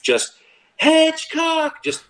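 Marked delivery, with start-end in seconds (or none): 0.8-1.7 top-of-the-lungs scream of a name. Retold or imitated. Coach screaming a player's name across practice, full yell. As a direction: yell it across the field, high and loud. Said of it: "at the top of his lungs screams".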